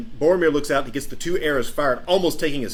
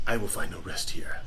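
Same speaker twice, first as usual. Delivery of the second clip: brooding Boromir; 9 semitones lower, 6 dB quieter than usual. Retold or imitated. Quoting favorite Boromir line.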